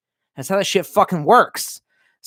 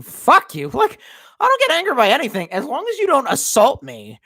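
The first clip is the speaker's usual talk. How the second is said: usual speaking voice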